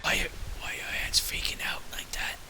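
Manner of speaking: soft, voiceless baffled whisper; stage whisper. Acted. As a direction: whisper it so only your companion hears. Whispers the question to his companion.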